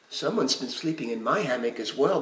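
ordinary delivery

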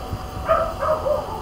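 soft squeaky giggle, way up high; high breathy laugh. Real, not acted. Amused by poop girl, soft high giggle. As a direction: let a high airy laugh slip out.

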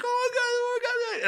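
very high, breakneck mock squeal; retold excitement. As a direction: imitate a son beside himself with joy. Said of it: "just as excited as me".

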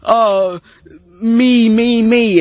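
high, loud me-me-me whine; mock a self-pitying complainer. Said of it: "that person who goes".

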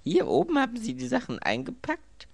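high, loud groaned question; aghast groan. A narrator's reading. Robbed man learning his servant packed the loot, groaning. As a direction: groan it out in dismayed disbelief. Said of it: "stöhnte Anthony".

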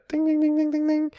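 high, ringing "ding" sounds; imitated bell dings. Storyteller marking his lucky chance, high bell-like dinging.

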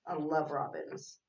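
plain reading voice

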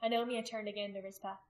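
plain reading voice

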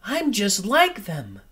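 put-on high, small baby bat voice; little bat's thought. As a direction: think it hopefully as a little bat. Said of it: "thought Stella Luna".

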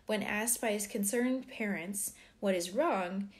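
ordinary talk

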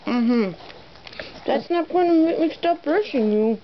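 normal talking voice

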